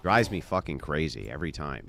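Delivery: usual speaking voice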